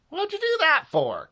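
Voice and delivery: high, loud squeal of protest; indignant cry. A narrator's reading. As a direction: cry out in baffled dismay. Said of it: "cried Harold".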